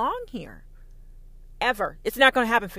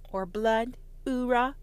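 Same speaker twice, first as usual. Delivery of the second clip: normal talking voice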